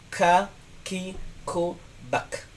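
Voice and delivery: "Ka, ki, ku" with a puff of air after each k, fading soft; teacher models the aspirated kaf; breathy Arabic kaf. Shown as a demonstration. Teacher demonstrating kaf to students, airy k. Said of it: "harus ada aliran angin yang keluar".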